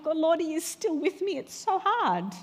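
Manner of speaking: high, pleading plea to God; pleading prayer. Retold or imitated. Believer telling God it's hard, high and pleading. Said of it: "Tell him".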